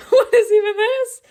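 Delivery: very high, loud squeal; laughing disbelief. Real, not acted. Player baffled by the game's story, high loud squeal.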